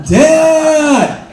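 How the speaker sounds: loud high call across a bar. Retold or imitated. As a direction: shout for Dad over the noise. Daughter calls out on FaceTime.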